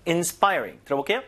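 ordinary talk

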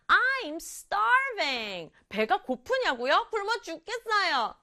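lightly voiced whining boy; teacher playing a starving boy, drawn out; acting the hungry boy. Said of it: "그랬더니 남자아이 대답".

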